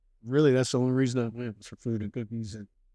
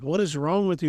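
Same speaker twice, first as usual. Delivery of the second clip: higher-pitched mock indignation; mild retold exasperation; 7 semitones higher than usual. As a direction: retell it as baffled annoyance, pitched up. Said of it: "it made me so mad".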